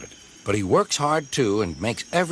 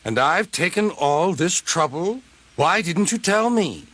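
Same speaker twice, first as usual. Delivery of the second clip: indignant engine voice; 7 semitones higher than usual. Acted. Diesel outraged.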